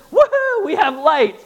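high, loud whoop easing into glee; imitated excitement. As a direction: act out a man thrilled by everything. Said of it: "and he's all excited".

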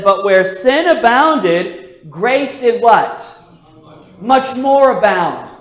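slow, stressed scripture quote; emphatic verse reading. Acted. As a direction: lean hard on the verse's key words.

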